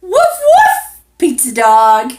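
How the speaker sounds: very high, loud woofs; playful barking. Acted. To the child, answering the pizza dog, playful. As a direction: bark like a small dog, then greet.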